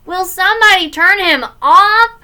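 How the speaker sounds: high, loud, fed-up cry; annoyed big-brother shout; exasperated plea. Acted. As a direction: appeal to the room in exasperation.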